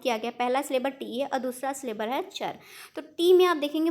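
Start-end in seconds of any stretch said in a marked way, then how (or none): 2.9-3.9 टी said louder with extra breath; stress on टी. Shown as a demonstration. Teacher shows students the stressed syllable, punching टी. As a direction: push air into टी. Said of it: "ज़्यादा सांस लगाने की ज़रूरत".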